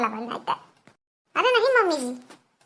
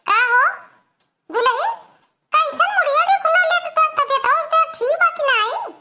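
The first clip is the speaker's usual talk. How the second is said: high, loud put-on voice of a fussing mother-in-law; lifted comic village-aunt voice; coaxing mother-in-law; 6 semitones higher, 4 dB louder than usual. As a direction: call out to the bride, high and nagging. Mother-in-law coaxes silent bride.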